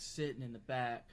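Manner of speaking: ordinary delivery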